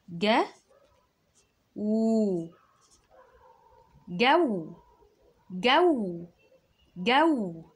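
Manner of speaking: جو called high and loud; high loud dictation. Shown as a demonstration. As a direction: call out each syllable. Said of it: "عايزة اكتب كلمة". (جو رابو بصوت عالي)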